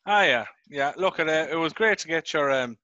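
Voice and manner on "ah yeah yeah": ordinary talk, nothing marked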